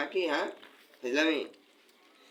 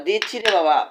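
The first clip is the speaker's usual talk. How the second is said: higher, louder "দিচ্ছি রে বাবা"; louder reassurance; 7 semitones higher, 12 dB louder than usual. Real, not acted. Telling the crying cat food is coming, louder. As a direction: raise your voice on "দিচ্ছি রে বাবা" to calm the cat.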